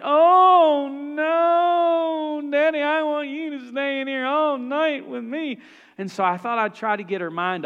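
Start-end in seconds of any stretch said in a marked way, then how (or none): 0.0-5.6 put-on high, loud pleading child's whine; whining little girl. Daughter begging dad to stay all night, high whine. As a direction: whine like a little girl who won't let go.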